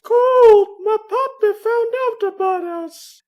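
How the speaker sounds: put-on a frightened girl blurting out the news; panicked daughter. A daughter warning her lover that her father knows.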